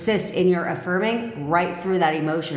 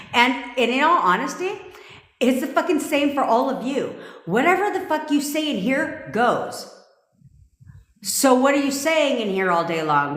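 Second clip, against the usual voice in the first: excited; 6 semitones higher than usual. Real, not acted. Heated rant to viewers.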